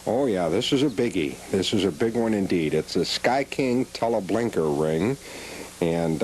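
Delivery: ordinary delivery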